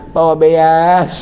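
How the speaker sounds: "beas" drawn out slow and lisping, laughing; husband stammering to his wife, lisping and laughing. Retold or imitated. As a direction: say "Bawa beas" slowly like a tongue-tied man. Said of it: "Langsung cadel".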